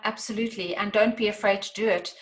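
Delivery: usual speaking voice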